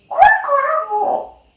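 high sing-song mimic. Shown as a demonstration. Mimicking a sound for the bird.

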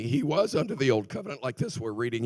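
ordinary talk, nothing marked

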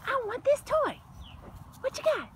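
lightly high puppy voice; be the stubborn puppy; voicing the dog. Owner speaks for the puppy, mildly high.

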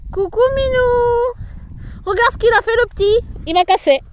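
high loud pet voice. Real, not acted. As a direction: coo at the horse. Baby talk to horse.

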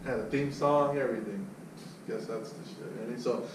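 ordinary talk

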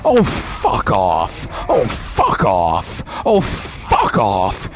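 slow, drawn-out, low-pitched rage; furious cursing. Real, not acted. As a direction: drag each curse out in real anger. Cursing at the game boss.

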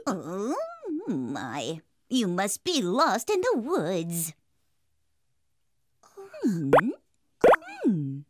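a hint of a sly old witch; fearsome old hag. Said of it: "a fearsome old hag appeared".

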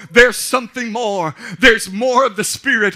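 loud, high, driving sermon; fiery preaching. Acted. Pastor to congregation, fiery preaching. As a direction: lift the congregation up.

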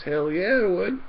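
mock eager woman, up high and loud. Joke teller voices the woman saying yes, high and eager. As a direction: play the woman as gleefully keen. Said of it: "And the woman goes".

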